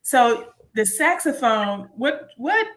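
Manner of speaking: usual speaking voice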